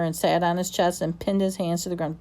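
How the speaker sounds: ordinary delivery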